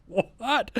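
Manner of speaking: very high, stunned 'what?'; stunned what. Retold or imitated. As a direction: squeak it out in disbelief. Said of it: "I'm like".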